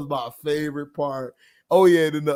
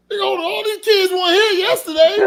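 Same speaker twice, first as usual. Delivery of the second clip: higher, fast, shouted; mock alarmed shout; 13 semitones higher, 5 dB louder, faster than usual. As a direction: blurt it out in a panic. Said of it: "and I was like".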